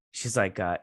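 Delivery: ordinary delivery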